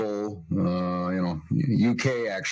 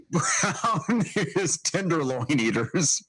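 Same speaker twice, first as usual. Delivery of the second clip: laughing; 5 semitones higher, slower than usual. Real, not acted. Laughing while reading map caption.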